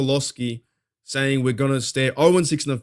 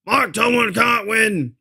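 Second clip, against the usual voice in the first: slow, higher hammed-up pundit pronouncement; slow mock radio-host verdict; 9 semitones higher, 4 dB louder, slower than usual. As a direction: ham it up like a radio character. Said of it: "that Adam Shine dude was like".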